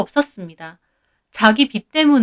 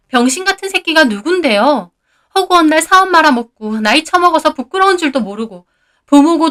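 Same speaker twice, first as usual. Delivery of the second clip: higher, louder furious yell; shouting back; 5 semitones higher, 4 dB louder than usual. A narrator's reading. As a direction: scream it at the brother-in-law. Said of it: "저도 못 참고 소리 질렀습니다". (참다못해 소리 지르는 며느리)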